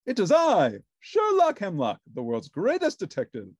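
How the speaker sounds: loud, high, pompous proclamation; Sherlock Hemlock voice. A narrator's reading. Detective announcing himself at Ernie's door, pompous and loud. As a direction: announce yourself as the greatest detective.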